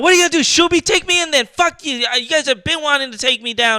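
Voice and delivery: loud, high mimicked defiance; retold angry rant. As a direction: act out a ranting customer, voice way up. Retells customer ranting at firefighter.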